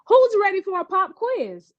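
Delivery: a teacher springing a quiz on the class; cheery teacher. A narrator's reading. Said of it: "asked the teacher".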